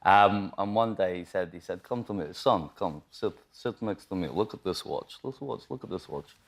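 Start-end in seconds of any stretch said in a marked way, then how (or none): 1.8-6.4 put-on softer, lightly done Russian accent; imitating Russell Crowe's Russian accent, low-key. Retelling Russell Crowe calling him over, light Russian accent. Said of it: "a Russian accent".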